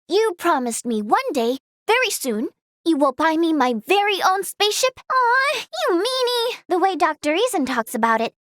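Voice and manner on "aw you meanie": high, whiny mock complaint; playful pout. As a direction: pout at being teased. Teased about promised spaceship.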